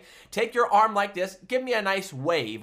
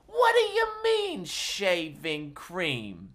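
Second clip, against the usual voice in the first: mock outrage; slower than usual. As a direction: ham up the disbelief. Repeats with rising pitch.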